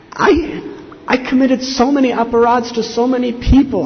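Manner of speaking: high, loud, anguished retelling of a penitent; retold tearful plea. Madhai weeping to Lord Nityananda over his offenses, retold as anguished pleading. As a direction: plead at full voice, imitating remorse. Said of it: "he was weeping and crying".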